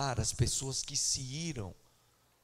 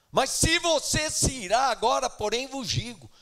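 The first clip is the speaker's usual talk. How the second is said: fiery preaching; 9 semitones higher, 6 dB louder than usual. Acted. Preaching at full force.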